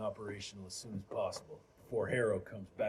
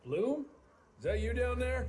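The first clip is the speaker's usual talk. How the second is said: high, loud call down below; 11 semitones higher, 4 dB louder than usual. Acted. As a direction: shout down to the man below. Calling down to someone below.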